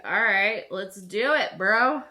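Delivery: loud, hyped "let's do it" to a buddy; pumped-up agreement. Retold or imitated. To her doctor about genetic testing, loud and pumped up. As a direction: fire it off like a gym bro getting hyped.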